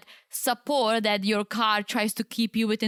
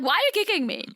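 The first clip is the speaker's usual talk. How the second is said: higher-pitched puzzled protest; mock indignant; 9 semitones higher, much faster than usual. Retelling reaction to husband's kick, mock indignant. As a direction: act out a mildly indignant question, pitched up.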